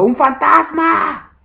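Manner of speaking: high, loud, drawn-out cry of fear; angstige kreet. A narrator's reading. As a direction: cry out in terror, pitch leaping way up. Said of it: "dieron voces de miedo".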